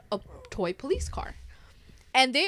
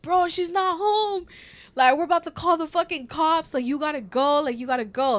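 raised, higher voice acting out a standoff; retold pleading protest; 5 semitones higher, 5 dB louder than usual. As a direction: plead loudly with someone to leave, as retold. Retold standoff with intruder at window.

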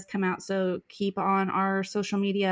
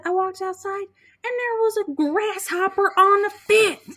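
lightly voiced child, higher; a hint of a little girl telling dad; little daughter; 12 semitones higher, 5 dB louder than usual. Young daughter quoted at dinner.

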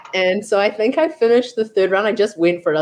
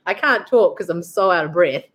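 ordinary talk, nothing marked

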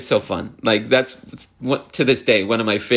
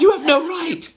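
high, loud comic indignation; mock outrage; 3 dB louder than usual. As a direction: scold like an offended film fanatic, hammed up. Quoting irate movie-loving killer.

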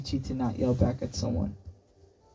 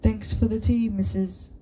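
higher start, tailing away awkwardly; trailing off embarrassed; 7 semitones higher than usual. A narrator's reading. Sunny thanking her friend's mother, unsure of her name, trailing off embarrassed. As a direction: let the thanks peter out, embarrassed. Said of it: "Sunny trailed off, embarrassed".

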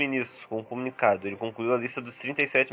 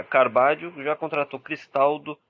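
normal talking voice

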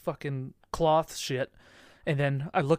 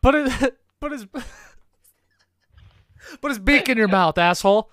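higher, loud, laughing through; 8 semitones higher, 8 dB louder than usual. Real, not acted. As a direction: laugh out the joke. Laughing joke about a goose.

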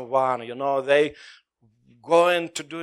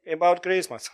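ordinary talk

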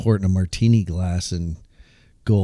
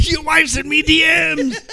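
Conor McGregor trash talk; 7 dB louder than usual. Pretend. Friends joking about aliens, mock Conor McGregor trash-talk shout. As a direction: taunt like a cocky Irish fighter, yelling it. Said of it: "I'm sending Conor McGregor".

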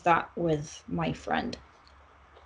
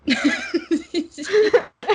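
loud, high laughing fit; high peal of laughter; burst of laughter; 14 semitones higher, 7 dB louder than usual. Real, not acted. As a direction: crack up helplessly.

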